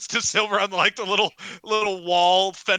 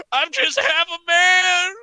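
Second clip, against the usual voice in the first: very high, loud, slow wail; hammed-up howl of self-pity; anguished wail; 12 semitones higher, 10 dB louder, slower than usual. Maimed wrestler laments his lost limbs, wailing. Injured man laments loudly.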